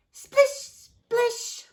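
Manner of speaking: high splish splish; splashing sound. A narrator's reading. Frank's toes splashing in the pond, high voiced. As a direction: make a watery splish.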